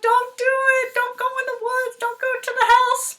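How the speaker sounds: high squeaky warning; high chick voices; chicks pleading. Retold or imitated. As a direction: plead like frantic little chicks. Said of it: "chicks were trying to warn".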